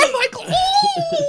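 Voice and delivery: high panicked shout. Acted. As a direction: scream for rescue. Kid calls for help.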